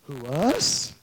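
low-pitched incredulous question with rising end; feigned disbelief. Acted. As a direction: play the accused, taken aback. Acts the accused answering Stephen.

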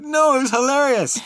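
high, loud, amused insistence; laughing insistence. Retold or imitated. Telling the mortified class it was hilarious, high and laughing. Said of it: "I said".

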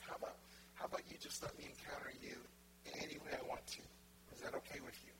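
soft, tender asking; hushed, intimate offer; gentle divine request. Acted. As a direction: ask softly, as God inviting permission. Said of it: "And he's like".

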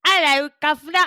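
deliberately bad British accent; mock British accent. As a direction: ham up a cheeky British greeting. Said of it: "That's not really my British accent".

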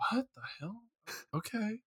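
very soft, bewildered murmur; baffled aside. Acted. Bewildered viewer left sitting there after the mom scene, hushed bafflement. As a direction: say it to yourself, hushed and puzzled. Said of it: "you're just sitting there left going".

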